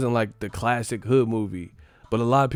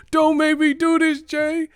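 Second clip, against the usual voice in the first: much higher, louder pleading quote; pleading movie cop; 6 dB louder than usual. Retold or imitated. Mimicking the cop begging Jay, high and loud. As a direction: plead way up high, half joking. Retelling a movie standoff scene.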